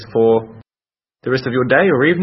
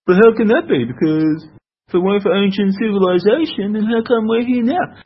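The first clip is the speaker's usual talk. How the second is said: high-pitched, put-on doubter's voice; mock a naive objector, pitched way up; mocked baffled objector; 10 semitones higher, 4 dB louder than usual.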